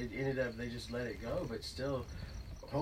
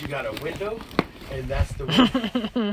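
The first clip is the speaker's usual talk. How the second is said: slow, louder, each word laid down; emphatic grandfather advice; 4 semitones higher, 9 dB louder, slower than usual. Retold or imitated. Grandfather lecturing the boy slowly and firmly about the window. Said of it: "He goes".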